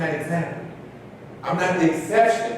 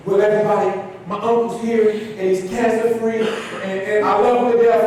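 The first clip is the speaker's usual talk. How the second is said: higher and louder, gushing with joy; excited announcement; 7 semitones higher, 3 dB louder than usual. Retold or imitated. Student telling the crowd her uncle is cancer-free, overjoyed. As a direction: burst out the happy news to the crowd.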